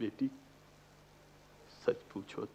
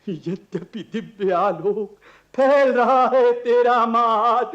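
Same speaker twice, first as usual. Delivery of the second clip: higher, louder, slowed quoting with a laugh; father laughingly urges daughter to sing the verse; playful coaxing; 8 semitones higher, 7 dB louder, slower than usual. Acted.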